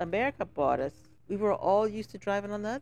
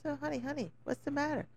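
put-on Crucian island accent, higher and much softer; a soft, high West Indian woman's voice; 7 semitones higher, 9 dB quieter than usual. Cruise woman asking a tourist what's wrong, in a soft island accent. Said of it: "and she said".

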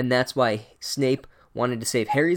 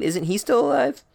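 squeaky schoolboy; 11 semitones higher than usual. Retold or imitated. Harry voiced asking Dumbledore.

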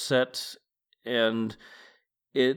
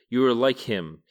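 ordinary delivery

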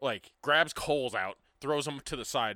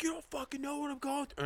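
imitated meltdown; 14 semitones higher, 4 dB quieter than usual. Distraught kid lashing out at his comforter, mock meltdown. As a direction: act out someone losing it, voice pitched way up. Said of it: "kid just fucking loses it".

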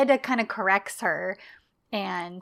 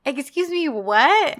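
mock indignant surprise; 5 semitones higher, 6 dB louder than usual. [name] reacts to insult.

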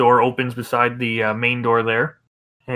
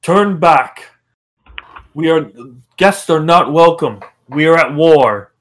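booming guard voice from afar; magically amplified voice; 5 semitones higher, 11 dB louder than usual. Acted. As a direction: boom out the warning, loud. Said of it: "you just hear a booming voice from the back".

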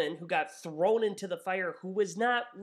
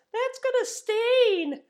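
put-on high girl voice; 4 dB louder, slower than usual. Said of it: "some girl at the far back goes".

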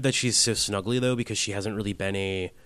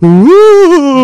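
high loud ghost wail; spooky ghost ooh; 20 dB louder than usual. Pretend. Ghost noise in reply.

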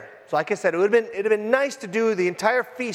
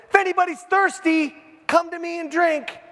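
ringing proclamation, pitched high; bold declaration; 8 semitones higher than usual. Retold or imitated. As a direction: declare it boldly to the crowd. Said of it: "that is just a weird declaration".

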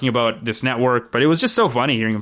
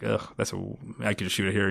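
reluctant groan; 4 semitones lower, 11 dB quieter, faster than usual. Retold or imitated. Reluctant reply retold.

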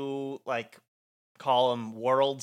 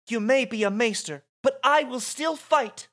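higher, louder heroic defiance; defiant declaration; 11 semitones higher, 6 dB louder than usual. Acted. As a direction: declare it boldly to a foe. Yuna defies Seymour in script.

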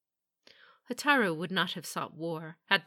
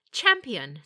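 "Champion" hit louder and higher, then falling; forced emphasis; 4 semitones higher, 9 dB louder than usual. A narrator's reading. Dragon warrior greets her superior, forced stress on "Champion". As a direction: lean hard on "Champion", politely but forced. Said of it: "emphasis on the word champion sounded forced".

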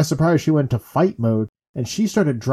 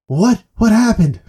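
higher, louder urgent questions; mock alarmed mom; 8 semitones higher, 6 dB louder than usual. Mom pulling up to robbed woman, urgent shout. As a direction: imitate a mother rushing to help. Acts out mom reaching the victim.

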